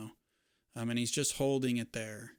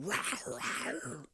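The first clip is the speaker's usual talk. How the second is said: high growling big cat rah; cat roar; 11 semitones higher, 3 dB quieter, slower than usual. Pretend. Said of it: "a giant cat with you who's also, like, going".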